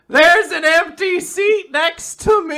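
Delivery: high, loud, slow panicked cry; mock terror. Co-host plays the terrified student seeing the empty seat, mock terror. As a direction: wail it in hammed-up horror. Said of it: "There's a panel as he's terrified".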